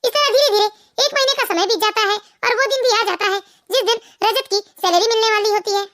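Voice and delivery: ordinary delivery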